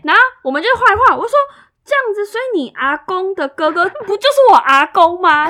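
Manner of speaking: 又快又大声地推论; 越说越激动，声音拔高; excited realization. Retold or imitated. (画族谱时激动大声推出结论)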